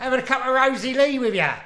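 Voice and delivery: high-pitched Cockney shark patter. Acted. Shark inviting the swimmer for tea, Cockney shark voice. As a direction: lay on the rhyming slang, high and Cockney. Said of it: "It's a Cockney shark".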